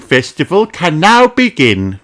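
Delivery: high announcing rabbit voice; Miss Rabbit announcing. Acted. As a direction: announce the festival opening.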